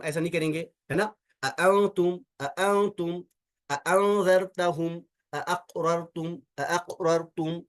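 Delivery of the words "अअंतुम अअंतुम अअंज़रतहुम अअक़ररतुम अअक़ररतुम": अअंतुम with both hamzas fully clear. Shown as a demonstration. Teacher showing students how to read both hamzas, then the pronunciation. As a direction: say अअक़ररतुम slowly with each hamza sounded. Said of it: "इस तरह से".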